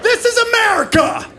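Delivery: high-pitched outraged scream; hammed-up screaming meltdown. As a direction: yell it like a frantic shopper losing it. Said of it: "I started screaming like".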